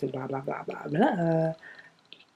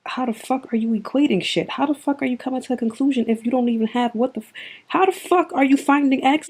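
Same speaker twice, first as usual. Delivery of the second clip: rising into an exasperated, louder rant; fed-up ranting that climbs higher and louder; angry rant; 7 semitones higher, 3 dB louder than usual. Real, not acted. Ranting at a hater about how they judge her, building angry.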